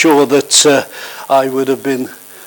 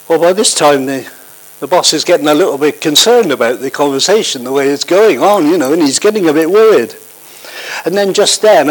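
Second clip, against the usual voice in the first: normal talking voice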